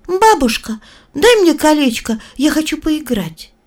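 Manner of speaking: put-on little girl voice. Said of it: "сказала бабушке".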